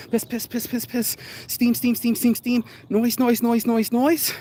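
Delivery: high rhythmic repeated word-noises; kettle sputtering. Retold or imitated. Mocking friends venting like a tea kettle, high rhythmic sputter. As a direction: sputter each word like steam escaping a kettle. Mimicking a boiling, noisy kettle.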